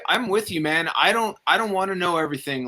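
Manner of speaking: ordinary talk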